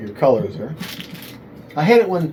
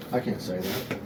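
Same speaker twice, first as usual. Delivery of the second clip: normal talking voice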